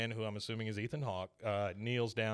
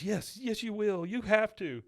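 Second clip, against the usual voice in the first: higher pleading voice, mock-earnest; pleading son; 9 semitones higher, 3 dB louder than usual. Son pleading with dying father, higher voice. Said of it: "Ethan Hawke's character says".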